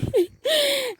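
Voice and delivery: short high-pitched laugh. Real, not acted. Speaker amused while retelling what the sister said, high laugh. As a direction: break into a brief, high laugh.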